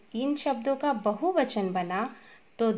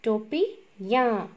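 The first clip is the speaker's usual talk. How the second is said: slow, split apart; slower than usual. Shown as a demonstration. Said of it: "तो क्या हो गया?".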